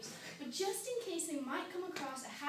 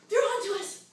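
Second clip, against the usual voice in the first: high, loud cry of alarm; alarmed shout; 7 semitones higher, 12 dB louder than usual. Acted. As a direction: raise the alarm to the others. Acts alarmed spiders overhearing name.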